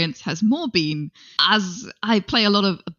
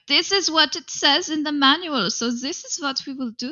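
hammed-up fake German accent, raised pitch; silly German accent; 6 semitones higher than usual. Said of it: "a silly fake German accent there".

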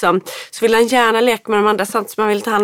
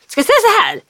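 put-on bossy child; 10 semitones higher, faster than usual. Son correcting mother.

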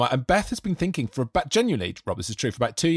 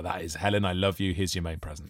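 mock tender; 7 semitones lower, 6 dB quieter than usual. Mock tender present speech.

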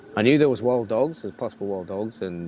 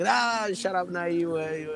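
higher, mock ribbing from friends; imitating their teasing; pitched-up mock tease; teasing retort; 9 semitones higher than usual. Quoting the laughing other family.

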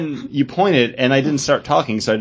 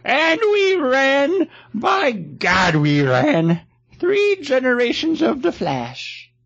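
put-on high, slow, creaky old man voice; 13 semitones higher, much slower than usual. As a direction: play an aged hero reminiscing. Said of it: "That's my old man voice".

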